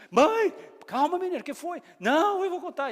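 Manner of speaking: high, loud breathless voices; excited mother-daughter exchange. Retold or imitated. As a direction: play both of them bursting with news. (diálogo empolgado entre mãe e filha)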